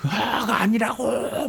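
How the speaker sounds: 높고 길게 늘여 떼쓰는 아이 목소리. Retold or imitated. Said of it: "막 성질 내고 아니라". (아이처럼 성질 내며 떼쓰듯)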